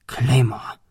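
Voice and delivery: breathless whisper of recognition; stunned whisper on recognizing the man. Acted. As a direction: whisper the name in shock.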